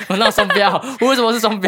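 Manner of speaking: real indignant outburst, half laughing; indignant protest. Real, not acted. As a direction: push back hard against the accusation. Accused of double standards.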